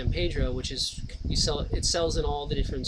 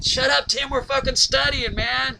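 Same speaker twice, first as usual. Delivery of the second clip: higher mock yell of fed-up neighbours; annoyed dorm guys; 9 semitones higher, 5 dB louder than usual. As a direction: shout him down like irritated students.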